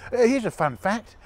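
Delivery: ordinary talk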